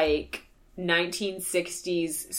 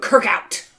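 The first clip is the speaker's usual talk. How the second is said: clipped, louder sign-off; angry voicemail; 5 dB louder than usual. Retold or imitated. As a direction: bark it like an angry captain. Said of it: "angry voicemail to them".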